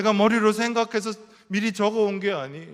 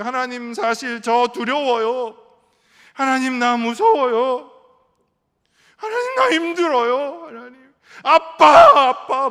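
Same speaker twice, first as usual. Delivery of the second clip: higher and louder, pleading and breaking; pleading cry to God; 6 semitones higher, 12 dB louder than usual. Retold or imitated. Pastors' wives crying out their fear to God, higher, louder and pleading. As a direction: cry out to God like a frightened child. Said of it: "말하기 시작했어요".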